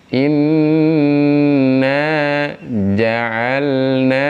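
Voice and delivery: "Inna ja'alna" recited with a clear lam; tajwid-style "Inna ja'alna"; recitation demo. Shown as a demonstration. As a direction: chant "ja'alna", sounding the l fully before the n. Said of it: "jelaskan huruf lamnya".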